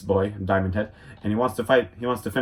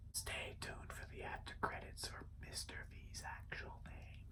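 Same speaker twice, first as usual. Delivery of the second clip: hushed unvoiced whisper; 25 dB quieter than usual. Real, not acted. Promising the villain's name, whispering. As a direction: breathe it as a teasing secret. Teasing aside.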